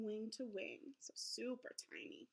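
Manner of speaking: whispered softly. Shown as a demonstration. Teacher to students, whispering about the tiny butterfly. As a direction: whisper it to show how tiny. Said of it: "whispers to stress how tiny".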